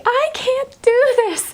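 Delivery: slow, high crying plea. Retold or imitated. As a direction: sob it out to her husband on the phone. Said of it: "I cried again".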